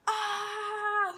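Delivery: high, loud re-enacted scream; clueless scream. Applicant copying the others' zombie screams, high loud scream. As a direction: scream along without knowing why. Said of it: "I was just screaming".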